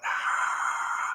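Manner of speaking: breathy mock roar of a crowd; imitated crowd cheer. Small group watching the baptism, imitated cheer. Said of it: "And they all went".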